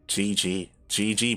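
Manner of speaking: ordinary delivery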